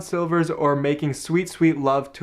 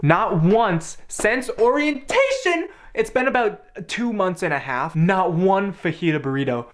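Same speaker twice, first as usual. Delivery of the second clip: mock outrage; 6 semitones higher than usual. Real, not acted. Ranting about missing dining hall dish.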